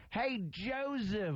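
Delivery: high, slow call of Hey, Joseph; playful Sal impression, high and drawn out. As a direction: imitate Sal calling the brother across the way. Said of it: "Sal says my brother's name".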